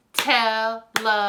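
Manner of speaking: Television broken into four separate, even beats; clapped-out syllables. Shown as a demonstration. Teacher clapping out the word for children, Television in four separate syllables. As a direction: chant Television syllable by syllable, gaps between, on one level note. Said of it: "count while we clap".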